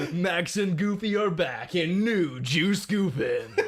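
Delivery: slow, high, hammed-up trailer voice; laughing mock trailer announcement; movie trailer announcer. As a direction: sell it like a cheesy movie trailer.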